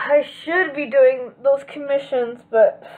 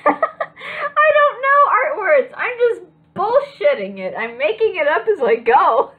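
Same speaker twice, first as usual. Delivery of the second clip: laughing; 9 semitones higher, 5 dB louder than usual. Real, not acted. Laughing at own bluffing.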